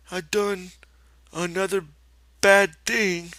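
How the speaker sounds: put-on high, childlike confession; Lenny confessing. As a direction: admit it like a guilty child.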